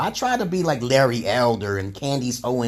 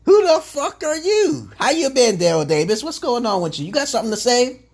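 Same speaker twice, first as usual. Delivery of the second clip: mock confrontation; 11 semitones higher than usual. Confronting a self-proclaimed Moor, mock challenging and high. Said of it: "I'm like".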